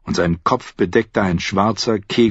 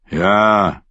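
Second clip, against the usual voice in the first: deep voice; 3 dB louder than usual. A narrator's reading. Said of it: "antwortete ein zweiter mit tieferer Stimme".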